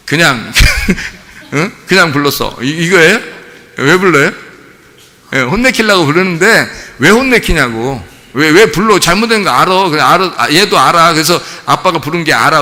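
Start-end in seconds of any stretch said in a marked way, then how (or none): none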